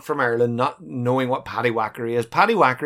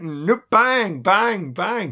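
mock gunslinger; 6 semitones higher, much slower than usual. Mocking Sulu with gun.

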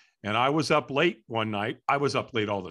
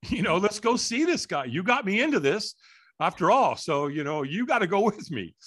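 excited buddy; 6 semitones higher than usual. Retold or imitated. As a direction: hype your buddy up to come along. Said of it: "He goes".

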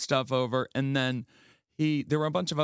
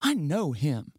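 higher-pitched voice on the name and question; mildly acted puzzled aunt; retold Aunt Snooki; 8 semitones higher than usual. Aunt asking grandmother if she's seen him, acted puzzlement. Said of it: "she goes".

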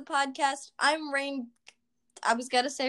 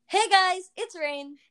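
excited greeting; 7 semitones higher, slower than usual. Real, not acted. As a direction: open the show with energy. Podcast intro greeting.

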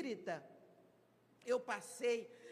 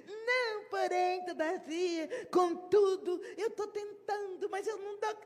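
much higher, whiny string of excuses; squirming excuse; 7 semitones higher, 4 dB louder than usual. Retold or imitated. Timid member dodging the question about speaking, whiny. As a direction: wriggle out of it, pleading.

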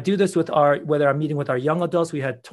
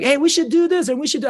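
excited, higher voice imitating the kids; bubbling over like eager children; 10 semitones higher, 4 dB louder, faster than usual. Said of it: "And they were like".

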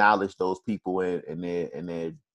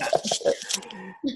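breathy laugh; 10 semitones higher, 4 dB quieter than usual. Real, not acted. Laughing at cohost.

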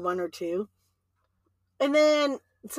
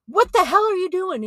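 loud, high-pitched parental outburst; mock-outraged raised voice; retold angry scolding; 8 semitones higher, 7 dB louder than usual. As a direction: imitate a parent catching a kid red-handed.

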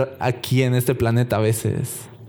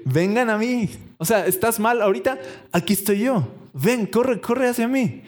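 higher, pleading call of Jesus; Jesus calling the weary to run to him, higher and eager; eager Jesus invitation; 6 semitones higher than usual. Acted. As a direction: urge them to come running to you. Voicing Jesus calling people to him.

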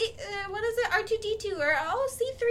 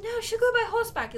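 higher-pitched imitation of a mother; insistent mother; 10 semitones higher than usual. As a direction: play the mother overruling them.

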